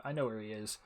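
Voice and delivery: usual speaking voice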